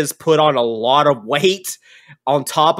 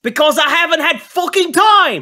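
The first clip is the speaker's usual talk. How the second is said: high, loud angry camp boss yelling back; gruff NPC shouting; 6 dB louder than usual. Acted. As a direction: bark it out like an irate old tinkerer. GM voicing NPC.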